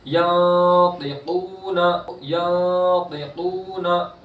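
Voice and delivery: slow, drawn-out Quranic chanting; tajweed recitation. Shown as a demonstration. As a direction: stretch the vowels in recitation.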